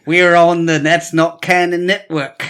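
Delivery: put-on slow, laughing Australian accent. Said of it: "Can you do an Australian accent?".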